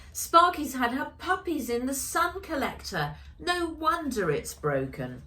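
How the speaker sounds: put-on young Ella, pleased and a bit surprised; girl's voice. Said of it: "said Ella".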